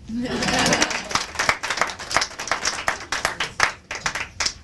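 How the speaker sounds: airy unvoiced laughter; breathy laugh. Real, not acted. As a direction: laugh it off under your breath. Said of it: "I got writer's block".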